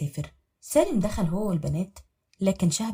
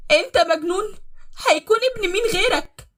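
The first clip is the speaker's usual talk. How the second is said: high, loud desperate wife; crying out in pain; 13 semitones higher, 7 dB louder than usual. Acted. Beaten wife crying out in pain to husband. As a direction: plead through the hurt. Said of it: "بتعيط بوجع".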